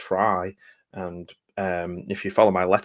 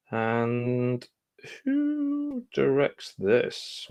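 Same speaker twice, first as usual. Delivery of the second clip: "who directs this?" drawn out high and slow; a long squeaky question; high slow squeak; 4 semitones higher, much slower than usual. Real, not acted. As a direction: stretch it up into a squeaky puzzled voice. Squinting, reading the small print.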